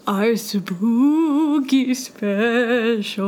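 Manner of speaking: drawn-out ghostly spooky tone. Pretend. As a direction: say it like a ghost haunting. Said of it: "I think that I sound like a ghost".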